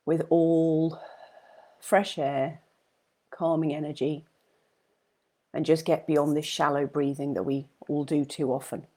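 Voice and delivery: soft-spoken breathing guide for viewers; guided meditation. Acted. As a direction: hush down and guide each breath gently. Leads a meditation.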